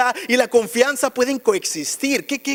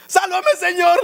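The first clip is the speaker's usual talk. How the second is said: clamor agudo de quien se hunde; grito de auxilio actuado; 7 semitones higher, slower than usual. Retold or imitated. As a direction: imita a Pedro gritando con la voz muy arriba. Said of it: "Empezó a gritar". (grito agudo imitado)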